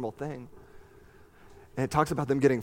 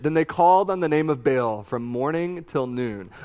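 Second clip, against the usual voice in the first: ordinary delivery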